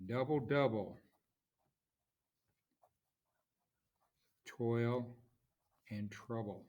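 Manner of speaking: "Double, double, toil and trouble" in separate beats, first syllables stressed; trochaic stress. Shown as a demonstration. Teacher quoting Macbeth for trochee, "Double, double, toil and trouble" in falling beats. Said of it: "where you have a stressed syllable followed by an unstressed".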